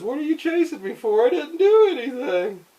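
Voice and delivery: high, loud pleading whine; whiny lizard. Acted. Lizard pleads with Spider-Man, high loud whine. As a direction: protest your innocence in a high loud voice. Lizard protests being chased.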